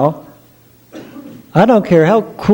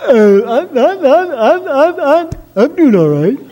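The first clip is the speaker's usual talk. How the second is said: stammering suitor; 11 semitones higher than usual. Acted. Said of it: "And Mort says".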